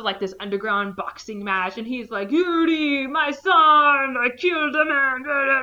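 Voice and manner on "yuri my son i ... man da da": a hint of a Russian accent, higher and louder; Russian father. Russian dad hyping son before boxing, hint of a Russian accent. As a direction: hype up your son like a Russian boxer's dad. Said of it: "this big Russian guy".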